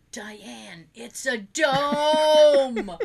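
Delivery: mock him with a huge stretched last word; hammed-up imitation, swelling into a held shout; mimicking the outburst. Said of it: "look at her and go".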